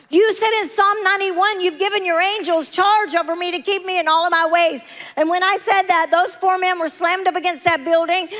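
high, driving testimony preaching; full-force preaching. Real, not acted. Preacher tells church of angels saving her, full-force preaching. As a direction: proclaim it with rising fervor.

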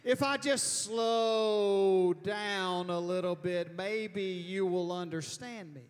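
drawn-out, over-loud talk to a foreigner; slow, loud mock explaining; exaggerated slow speech. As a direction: stretch the words out as if that breaks the language barrier. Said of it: "or they speak slower".

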